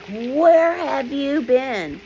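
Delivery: retold scolding, stretched out slow and high; pissed math teacher. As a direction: imitate an angry teacher demanding an answer, very slowly.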